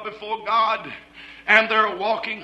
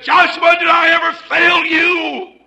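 much louder, higher, stern challenge; rebuking shout; 7 semitones higher, 11 dB louder than usual. Acted. Angel of the Lord rebuking Joshua, loud high challenge. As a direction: demand an answer, ringing out.

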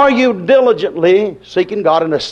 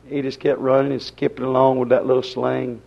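normal talking voice

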